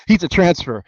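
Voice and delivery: host driving home the point to viewers; emphatic repeat. Real, not acted. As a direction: hit it again for effect. Repeats the line after laughing.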